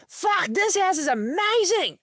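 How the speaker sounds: high-pitched, hyped-up mock dad voice; excited dad impression. Dad walking into the child's new house, mock excited outburst. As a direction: burst out in amazed delight, imitating dad. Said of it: "My mum and dad have very different energy".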